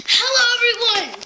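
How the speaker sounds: high, loud hello to the audience; big show greeting. Pretend. Host greeting viewers for the news, big high greeting.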